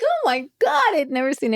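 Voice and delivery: high-pitched, laughing mock amazement; retold astonishment. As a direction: replay your disbelief, high and amused. Retelling her astonished reaction.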